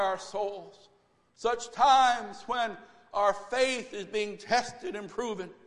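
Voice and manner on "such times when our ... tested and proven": ordinary talk, nothing marked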